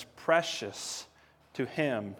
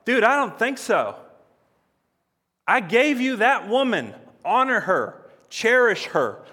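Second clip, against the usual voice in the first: higher and louder, firmly telling him off; stern rebuke; 7 semitones higher, 7 dB louder than usual. Acted. Jesus answering the husband who mistreats his wife, a louder stern rebuke. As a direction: lay down the law, exasperated. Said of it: "He's gonna be like".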